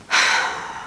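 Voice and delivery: long audible exhale; weary sigh. Real, not acted. Vlogger turning to the subject of Josh, long weary sigh. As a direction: let out a big sigh.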